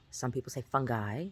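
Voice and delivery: fungi said FUN-guy, hard g and long i ending. Shown as a demonstration.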